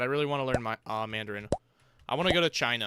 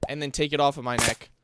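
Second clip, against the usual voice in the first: usual speaking voice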